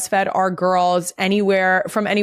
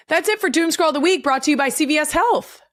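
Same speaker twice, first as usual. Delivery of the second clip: higher, fast upbeat host read; host outro read; 8 semitones higher, faster than usual. Acted. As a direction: wrap the segment like a podcast host. Show segment outro read.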